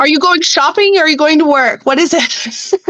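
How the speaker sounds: high, teasing mock question; lightly voiced puzzled local; mocking confused listener. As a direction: play the baffled listener for laughs.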